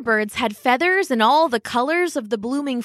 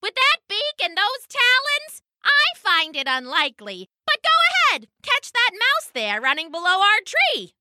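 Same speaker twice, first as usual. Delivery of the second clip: much higher, shrill and scornful; shrill mocking hawk; 10 semitones higher than usual. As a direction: screech at her like a hawk. Sparrowhawk mocks Fan.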